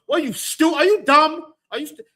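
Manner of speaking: put-on high, loud outburst at a kid; mock parent yelling. Preacher acts out a parent yelling at a child. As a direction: snap at the child like a fed-up parent. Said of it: "Yell at the kid".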